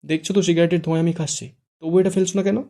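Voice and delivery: high, loud angry complaint. A narrator's reading. Fara coughing on smoke, angrily scolding Shuddho. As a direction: yell at him over the smoke. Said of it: "ফারা রেগে বলে".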